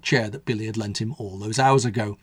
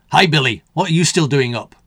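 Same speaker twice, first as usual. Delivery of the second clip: louder, lightly voiced gruff man; growled; 3 semitones higher, 6 dB louder than usual. A narrator's reading. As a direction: grumble at the kid. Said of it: "growled the older man".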